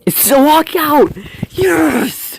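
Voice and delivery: high thrilled yell; excited. Real, not acted. Gamer sees a walkout, high excited cheer.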